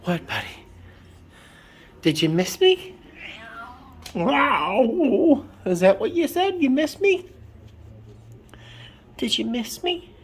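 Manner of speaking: high, cooing pet voice. Real, not acted. Greeting her cat at home, high cooing baby talk.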